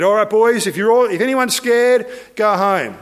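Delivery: rallying voice for a crowd of soldiers; addressing the troops. Retold or imitated. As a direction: call out to the men, acted. Gideon acted addressing his army.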